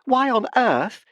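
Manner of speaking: high, loud and slow outcry; cried. A narrator's reading. Barbara cries at the professor's gloating, high and loud. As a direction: recoil and cry out in disgust. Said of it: "she cried".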